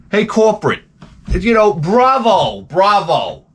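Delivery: high, slow mock applause to corporate; sarcastic cheer at the bosses; sarcastic bravo. Real, not acted.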